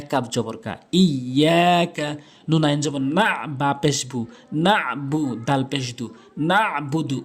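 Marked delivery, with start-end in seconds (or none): none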